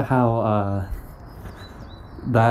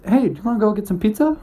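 high, fast excited guy; guy's voice; 11 semitones higher, much faster than usual. Retold or imitated. Boyfriend on the couch asks girlfriend, high and fast. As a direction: chirp the pizza offer high and quick. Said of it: "The guy says".

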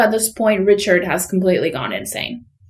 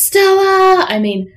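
high, loud bellow of her name; shouted name; 11 semitones higher, 4 dB louder, slower than usual. Retold or imitated. Husband roaring at wife locked in bedroom, mock yell. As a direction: yell it like Stanley in Streetcar.

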